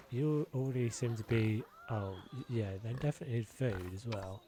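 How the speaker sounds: ordinary talk, nothing marked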